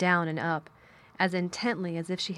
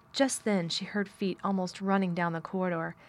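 usual speaking voice

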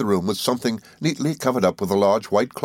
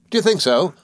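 put-on high, eager old schoolfellow; Traddles, good-tempered; 12 semitones higher, 4 dB louder than usual. A narrator's reading. Traddles welcoming his old friend, high and good-tempered. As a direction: explain earnestly in a lifted character voice. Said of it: "returned Traddles".

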